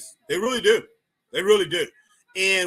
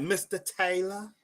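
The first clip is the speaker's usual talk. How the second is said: put-on slow, drawn-out Southern accent, softer; soft imitated Southern lilt; 7 dB quieter, slower than usual. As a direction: imitate a sweet Southern woman, slowly. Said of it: "very southern, very southern".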